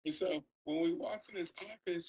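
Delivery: ordinary talk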